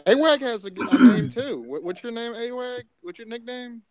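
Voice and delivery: excited. Real, not acted. Teasing a friend loudly.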